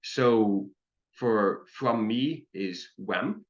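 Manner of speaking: wem, said like English "we" plus m, slowly; slow teaching pronunciation. Shown as a demonstration. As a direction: stretch out "wem" clearly for learners. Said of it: "the way you say this".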